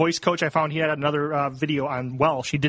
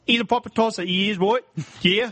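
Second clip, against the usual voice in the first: higher mock Essex with questioning tags; Essex accent; 5 semitones higher than usual. Shown as a demonstration. Podcaster combining the demonstrated phrases, Essex accent. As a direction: put on Essex, "right" as "roit". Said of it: "they say "right" and "yeah" a lot".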